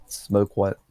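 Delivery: ordinary talk, nothing marked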